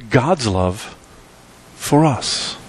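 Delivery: ordinary delivery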